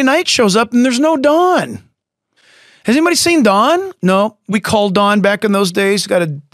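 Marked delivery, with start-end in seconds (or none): 2.8-4.4 high-pitched retold question; raised, searching voice; asking around. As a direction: call it out to the group, acting puzzled. Acted friends asking after missing Don.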